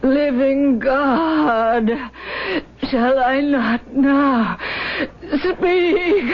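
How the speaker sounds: slow, rising, tear-filled plea; anguished cry. Acted. Sister praying over the dying woman, anguished cry. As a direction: wrench each word out as a prayer. Dying wife's struggle to speak.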